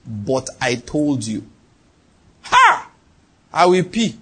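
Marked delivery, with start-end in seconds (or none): 0.1-1.4 low, softer, very slow reproach; God's dreaded reproach. Acted. God reminding him of what he was told, reproachful. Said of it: "One thing I fear is that statement". 2.4-2.9 sudden high cry of alarm; a short, high-pitched exclamation of dread. Acted. Man hearing the Lord's reproach, alarmed.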